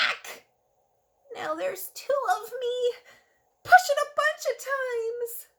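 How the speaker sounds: high, bright cartoon Larry voice; excited Larry. Larry tells the kids he has turned yellow, high and excited. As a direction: squeal it as the surprised little creature. Larry reacts to being pushed.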